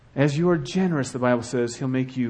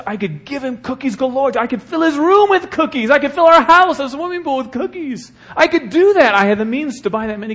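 rising much higher and louder to a near shout; building excitement; 11 semitones higher, 9 dB louder than usual. Real, not acted. Pastor exclaiming how many cookies he could give. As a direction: pile it on, bigger and bigger.

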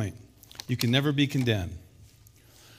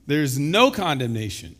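ordinary talk, nothing marked

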